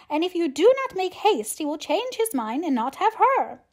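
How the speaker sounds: high, loud, agitated matron; urgent, high-pitched wail to a husband; calling out. A narrator's reading. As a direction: call out in a flustered uproar. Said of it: "called out as she entered the library".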